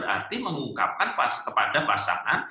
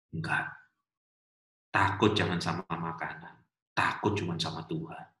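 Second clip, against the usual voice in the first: low, deliberate reply with pauses; a hint of solemn old pastor; lightly voiced elderly pastor; 9 semitones lower, 4 dB quieter than usual. Old pastor answering question about eating goat, solemn.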